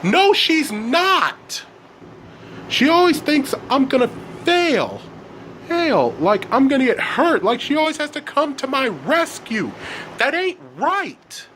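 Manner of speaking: high-pitched wailing outburst without much extra volume; screaming. A narrator's reading. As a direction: scream it like a wronged teenager. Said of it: "screamed Elliot".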